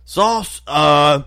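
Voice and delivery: high, loud and slow grandpa shout; mock old-man yell. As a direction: drag out barbecue sauce like a deaf grandpa.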